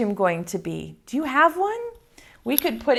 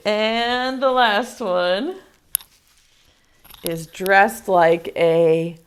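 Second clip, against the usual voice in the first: ordinary talk, nothing marked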